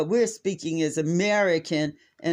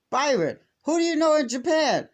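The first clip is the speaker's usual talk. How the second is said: exasperated demand; 10 semitones higher, 4 dB louder than usual. Retold or imitated. Aunt confronting nephew over bill.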